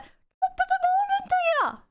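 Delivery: high, hammed-up stage Irish accent; cartoon Irish greeting. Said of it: "that's Irish people".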